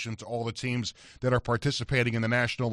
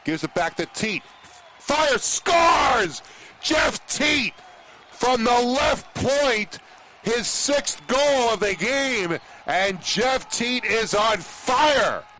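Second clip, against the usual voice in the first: play-by-play call; 9 semitones higher, 3 dB louder, slower than usual. Acted. Radio play-by-play goal call.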